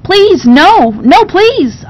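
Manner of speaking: high, loud pleading cry; desperate begging. Acted. Terrified girl begs the servants not to lock her away. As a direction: beg in panic not to be taken. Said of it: "I begged".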